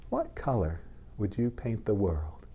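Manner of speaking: ordinary delivery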